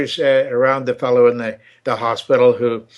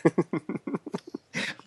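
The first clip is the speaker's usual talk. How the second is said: breathy laugh; 4 semitones higher, 13 dB quieter than usual. Real, not acted. Laughing at own joke.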